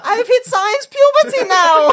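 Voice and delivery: high, slow, laughing falsetto; squeaky high voice. Acted. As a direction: laugh through a squeaky science-puberty voice. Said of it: "My voice is coming in fantastically".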